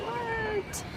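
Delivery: high, breathy-free squeak of disbelief; a tiny stunned gasp of a word; amazed what. Real, not acted. Amazed at Paris monuments.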